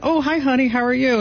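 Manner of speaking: high, singsong hello to a spouse; sweet greeting. Retold or imitated. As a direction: re-enact a sweet high-pitched phone greeting. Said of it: "and I went".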